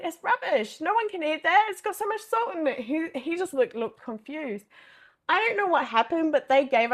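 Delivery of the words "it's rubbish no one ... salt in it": exasperated complaint. Retold or imitated. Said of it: "I said".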